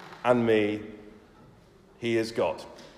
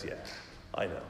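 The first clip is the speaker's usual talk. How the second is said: gentle reassurance; 8 semitones lower, 13 dB quieter, much faster than usual. Retold or imitated. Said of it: "comes alongside and says".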